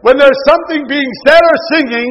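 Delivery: loud, rising sermon voice; preaching at full force. Acted. Preacher stirring up his congregation, in a loud preaching voice. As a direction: preach it out to the whole church.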